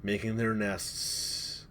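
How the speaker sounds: slow, hushed, eerie narration; suspenseful forest description, spoken slowly; game master scene-setting. Acted. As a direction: draw out each detail softly.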